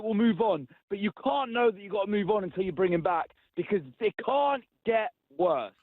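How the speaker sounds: ordinary talk, nothing marked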